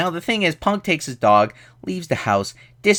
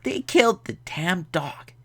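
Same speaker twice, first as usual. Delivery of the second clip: raised, put-on voice of disbelief; mock dismay; 6 semitones higher, 3 dB quieter than usual. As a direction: lament it in a higher comic voice. Outraged at dog's death in film.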